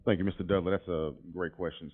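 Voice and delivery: ordinary delivery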